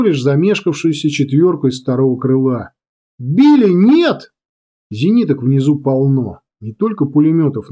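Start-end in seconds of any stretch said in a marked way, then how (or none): 3.2-4.3 high, loud cry to a comrade; anguished cry. A narrator's reading. Comrade watching plane hit, anguished cry. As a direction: call out helplessly to the doomed pilot. Pilot is being shot down.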